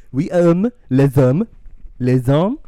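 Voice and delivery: mock French-accented "les hommes"; hammed-up French accent. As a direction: purr it like a stuffy Frenchman. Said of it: "do some French accent".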